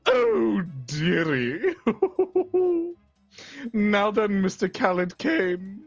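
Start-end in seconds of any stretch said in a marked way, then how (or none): 1.8-2.9 high theatrical villain cackle; a sinister barber's delighted cackle; evil cackle. Acted. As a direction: cackle like a gleeful storybook villain. Villain cackles over drawing.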